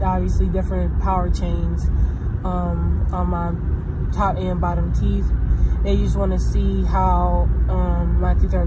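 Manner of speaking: talking with finger at mouth; finger on lips. Real, not acted. Seen 1: No stick; fingertip and long nail touch lips/teeth while talking.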